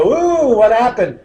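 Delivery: high, loud startled "Ooh"; imitating a surprised listener. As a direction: play the jolted audience member. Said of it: "and you go".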